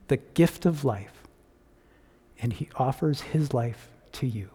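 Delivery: ordinary talk, nothing marked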